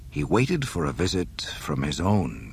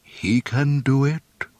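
higher, slow, measured assurance; assuring mentor; 8 semitones higher, 4 dB louder, slower than usual. A narrator's reading. Ben vouches for Luke to Yoda, slow assurance. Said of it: "Ben's voice said".

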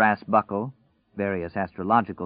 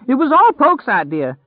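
high, loud frantic protest; terrified scream; 9 dB louder than usual. A narrator's reading. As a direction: scream the blame onto someone else. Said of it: "he screamed".

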